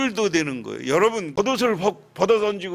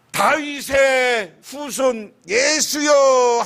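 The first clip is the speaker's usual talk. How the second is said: long drawn-out shout, slow and high; loud cry out; 5 semitones higher, 5 dB louder, much slower than usual. Retold or imitated. Blind beggar crying out to Jesus, long loud shout. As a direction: call out loudly across the crowd, stretching each word. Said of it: "크게 소리 질러".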